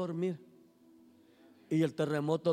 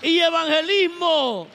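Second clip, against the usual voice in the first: rising ever louder and higher to a shout; rallying the congregation; 11 semitones higher, 9 dB louder than usual. Acted. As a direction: whip the church into joy.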